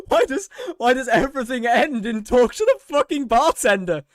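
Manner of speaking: half-laughing outburst at the endless quests; laughing rant. Real, not acted. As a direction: rant while cracking up. Laughing rant about bartender quests.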